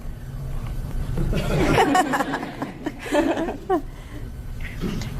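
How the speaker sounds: amused burst of laughter; hearty laugh. Real, not acted. Judge reads grandma's contract.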